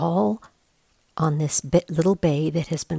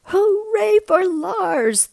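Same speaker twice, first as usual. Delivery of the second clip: high slow joyful cheer; 5 dB louder, slower than usual. A narrator's reading. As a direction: cheer it like a rescued crowd. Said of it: "they cried".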